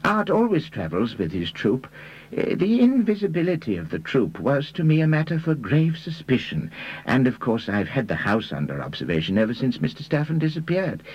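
high-pitched character voice, reporting deliberately; Reader. Acted.

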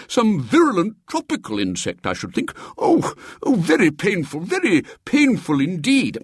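high complaining bishop voice; fussy bishop in pain. A narrator's reading. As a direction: whimper like a pampered bishop stung by an insect. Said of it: "said the bishop".

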